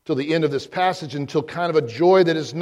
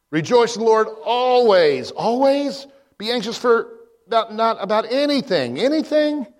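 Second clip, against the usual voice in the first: higher and louder, as if in disbelief; incredulous; 6 semitones higher, 4 dB louder than usual. Acted. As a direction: question the command with mock outrage. Incredulous questioning of the command.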